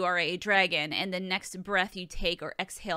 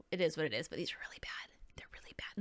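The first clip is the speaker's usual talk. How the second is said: whisper; 4 semitones lower, 9 dB quieter, faster than usual. Real, not acted. Confessing about her bad nails.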